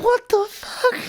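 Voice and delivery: very high-pitched squeal of disbelief; mock shock. Clerk looking at the ribeye thief, mock shocked. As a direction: squeak it out in comic bewilderment.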